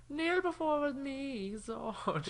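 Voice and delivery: high, very slow commanding villain; dark lord. Retold or imitated. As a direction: draw it out like a tyrant.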